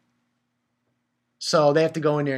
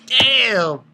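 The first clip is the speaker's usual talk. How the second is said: retold loud damn; 7 semitones higher, 9 dB louder than usual. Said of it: "I was like".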